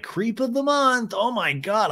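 high, slow mock disgust; mocking girl. To imagined creep, high mocking disgust. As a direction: sneer it like a repulsed date. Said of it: "they'd be like".

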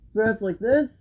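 muffled behind respirator mask; speaking through mask voicemitter; through a gas mask. Real, not acted. Seen 0: Full-face gas mask with filter worn throughout the labelled words.

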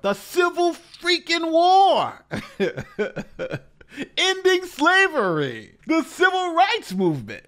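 loud, high, slow outburst of disbelief; exasperated shout. Real, not acted. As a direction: yell the answer like it's obvious. Ranting commentator answers own question.

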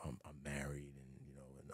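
a hushed, voiceless confession of being married; mock whisper. Telling the actress he is married, whispered. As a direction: drop to a whisper on the married part. Retelling his reply to actress.

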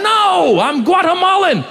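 very high, drawn-out comic cry; frantic outcry. Acted. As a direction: blurt it out in hammed-up desperation. Frantic passenger answers stewardess.

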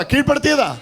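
high, insistent rallying cry; loud call-out. Shown as a demonstration. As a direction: call it out for the crowd to echo. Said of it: "ഉറക്കെ പറ".

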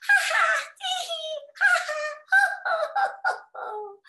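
high, loud storybook laughter; performed hyena laugh. A narrator's reading. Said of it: "have a laugh".

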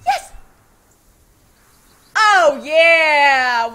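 loud cheering cry; triumphant. Real, not acted. Made it up the waterfall.

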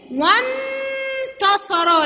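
"وانتصر" with a long, high held n; very slow, high wannn-tasara for "وانتصر"; jumping onto the noon. Shown as a demonstration. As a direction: skip the alef in "وانتصر" and sustain the n. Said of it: "لساني نط على النون على طول".

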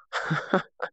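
breathy high chuckle; short laugh. Real, not acted. Gamer amused at finding the camera, short high chuckle. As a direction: chuckle briefly, mostly on the breath.

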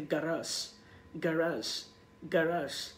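"গারাস" as ga-RAAZH, falling; model pronunciation. Shown as a demonstration. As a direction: drill "গারাস" slowly for repetition. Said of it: "উচ্চারণটা করতে পারেন আমার সাথে".

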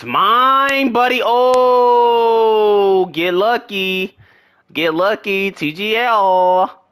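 high, drawn-out, loud crowing; gloating cheer. Real, not acted. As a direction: crow over a lucky catch. Gloating over a play in the game.

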